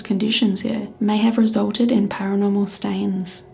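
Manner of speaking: loud, measured narrator read; documentary narration. A narrator's reading. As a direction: read it like a spooky documentary intro.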